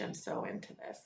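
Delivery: breathy unvoiced murmur to herself; quiet excited confession; whispered aside. Real, not acted. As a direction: whisper it almost under your breath. Teacher's quiet aside to class.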